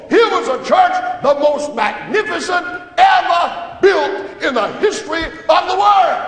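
soaring high, loud pulpit cry; preaching at full force. Acted. Preacher extolling the temple to his congregation, ringing and loud. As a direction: build the temple's glory to a peak.

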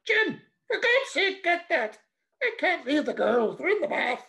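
high, loud shout from upstairs; wife yelling from the bathroom. Acted. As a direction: call down to him, exasperated. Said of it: "And then she shouted".

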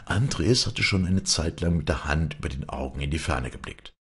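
ordinary delivery

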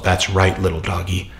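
low and soft, a sinister coo; creepy Josh voice. Retold or imitated. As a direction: purr it like a creepy handler to his pet. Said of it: "And Joshua's all".

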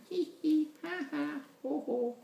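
high, measured monster tittering; spoken mock laughter. A narrator's reading. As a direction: read out a staged, softening laugh. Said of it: "They flew away laughing".

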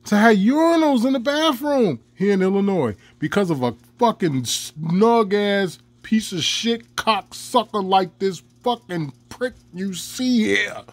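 ordinary talk

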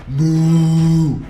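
long lowing moo; cow moo. Acted. [name] mooing instead of answering his friend. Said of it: "So now you're a cow".

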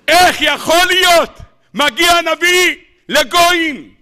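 high, indignant-sounding astonished question; incredulous outcry. Acted. Lecturer questioning a prophet among gentiles, incredulous outcry. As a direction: protest loudly that this cannot be. Acted incredulous protest at the story.

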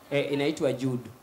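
low, mumbled answer; low seller voice. Acted.

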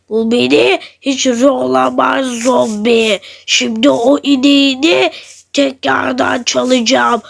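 low, slow sneering villain; low mocking zombie thief with a chuckle. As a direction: gloat like a creeping thief, low and unhurried.

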